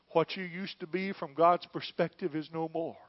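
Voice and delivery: usual speaking voice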